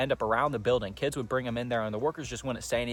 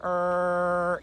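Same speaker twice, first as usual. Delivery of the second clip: creaking latch noise; 6 semitones higher than usual. Retold or imitated. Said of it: "You would hear it go".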